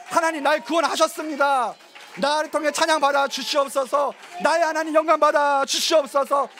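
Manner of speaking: high, loud proclamation to the congregation; rally the congregation to shout back in praise; ringing, sustained prayer declaration; preaching at full force. Real, not acted. Leads congregation in responsive proclamation.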